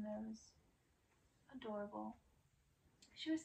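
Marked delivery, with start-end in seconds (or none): none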